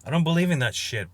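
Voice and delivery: loud, high and dismissive; fed-up outburst at the software; defiant retort. Retold or imitated. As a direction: snap back at the app in scorn. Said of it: "I'm like".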